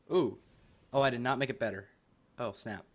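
ordinary talk

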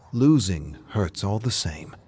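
plain reading voice

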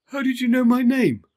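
high, startled little elephant voice; surprised Bobo. Acted. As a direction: caught off guard, amazed. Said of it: "Bobo asked with surprise".

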